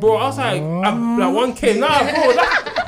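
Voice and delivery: loud, high laughing disbelief; laughing while telling. Real, not acted. Laughing to friends about the lost laptop. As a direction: laugh through the memory of losing it. Retelling losing bag, laughing.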